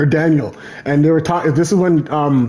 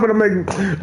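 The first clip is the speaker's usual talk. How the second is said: ordinary delivery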